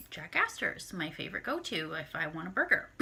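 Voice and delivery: usual speaking voice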